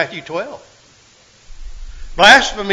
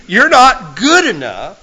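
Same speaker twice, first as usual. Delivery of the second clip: ordinary talk, nothing marked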